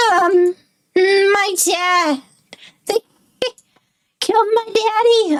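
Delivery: put-on high, slow little kid voice, shaken; small child's voice, close to tears; upset child voice. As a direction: play a frightened child whose dad was killed. Child reports murdered father.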